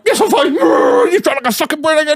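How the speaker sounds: high shrieked retort from the upset driver; high mock shriek of an upset woman; mock angry woman. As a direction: squeal it back like she's fuming. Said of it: "she was like".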